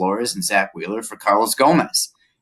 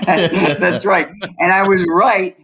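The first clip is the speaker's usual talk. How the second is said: laughing; 7 semitones higher, 3 dB louder, slower than usual. Real, not acted. Laughing back at the teasing host.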